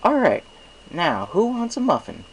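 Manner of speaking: high, loud cheery baker voice; cheerful baker. Acted. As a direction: call out the offer like a jolly shopkeeper. Mister Cake offers muffins.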